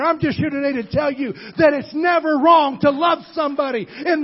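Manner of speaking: high, loud preacher's shout; preaching at full force. Acted. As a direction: thunder it out to the congregation. Preacher builds to climax.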